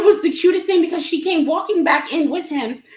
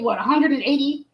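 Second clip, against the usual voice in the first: ordinary talk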